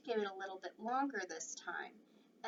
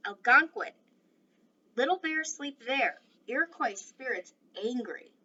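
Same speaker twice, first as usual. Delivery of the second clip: firm, louder warrior rejecting the signs; proud Iroquois brave; 3 semitones higher, 8 dB louder than usual. Acted. Little Bear rejects the teepee.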